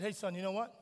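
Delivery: soft, low, tender apology to his son; father humbly apologizing to his son; gentle apology. Retold or imitated. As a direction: say sorry quietly, from the heart. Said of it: "After that, I said".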